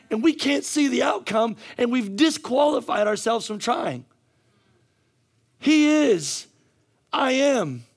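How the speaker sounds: high, loud declarations; full-force preaching. Real, not acted. Preacher proclaiming to the congregation, full-force preaching. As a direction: build to a shouted climax.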